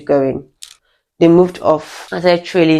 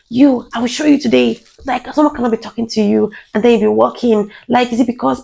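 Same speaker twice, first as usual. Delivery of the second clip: higher-pitched imitation of an indignant bully; mock threat; 6 semitones higher than usual. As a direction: scold and threaten, as if mimicking an aggressor. Acting out the man's threats.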